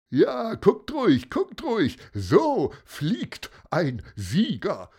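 high, loud gloating braggart; gloating Bölker. A narrator's reading. Bölker gloating at the others, high smug voice. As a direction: crow it up like a smug winner. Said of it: "lachte Bölker".

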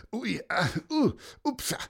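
high, effortful little grunts; puffing and struggling. A narrator's reading. Commissioner clambers down the dike, straining grunts. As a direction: huff through a clumsy climb. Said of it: "Es schnaufte laut".

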